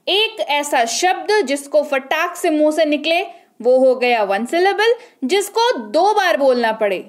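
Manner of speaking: ordinary talk, nothing marked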